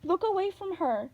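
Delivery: high, urgent warning cry; urgent shout. A narrator's reading. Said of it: "Annabeth shouted".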